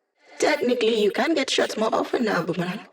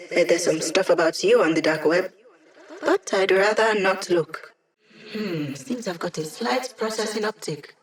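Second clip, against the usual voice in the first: ordinary talk, nothing marked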